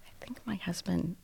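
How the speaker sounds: faint unvoiced whisper; whispered shock. Telling the dispatcher what happened, stunned whisper. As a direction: barely get the words out.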